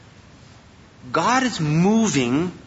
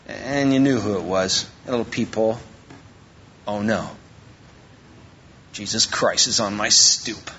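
mock dread; 10 semitones lower than usual. Mock dread at Jesus at door.

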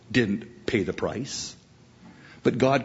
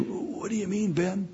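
soft, higher-pitched feeble question; weak, puzzled father; 8 semitones higher, 6 dB quieter than usual. Pretend. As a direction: play a bedridden dad, confused and hurt. Weak father questioning his son.

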